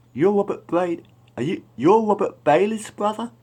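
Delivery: high, loud shocked double take. Retold or imitated. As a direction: blurt it out in high disbelief. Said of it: "Looking shocked".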